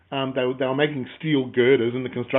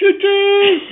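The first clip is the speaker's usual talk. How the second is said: high, loud choo-choo whistle; train whistle; 11 dB louder than usual. Pretend. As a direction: toot like a toy train. Train noise joke on "train of thought.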